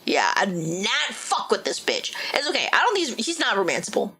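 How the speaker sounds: ordinary talk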